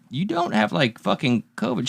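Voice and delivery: quiet aside. Retold or imitated. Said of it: "I'm like".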